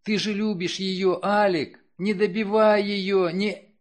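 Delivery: high, urgent begging; pleading. Acted. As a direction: plead desperately with him. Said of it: "уговаривая".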